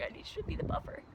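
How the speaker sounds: fast high whisper asking friend to shield her; hurried whisper, kid-like plea; whispered plea to her friend Chloe; urgent whisper. Retold or imitated. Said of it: "because I was like".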